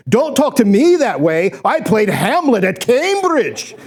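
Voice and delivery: indignant mock English thespian accent. Actor protesting to the director, hammy English accent. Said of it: "switches into an English accent".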